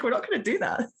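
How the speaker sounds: husband reining in an impulsive wife; imitating husband. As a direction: play the sensible husband, amused. Voicing husband's refusal.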